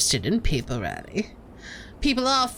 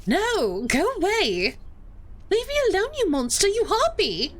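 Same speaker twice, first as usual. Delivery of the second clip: much higher, tearful cries of go away; sobbing Clarissa; 10 semitones higher than usual. Acted. Clarissa screams at Veronica through tears, much higher. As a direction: wail at her to leave. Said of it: "She collapses into floods of tears again".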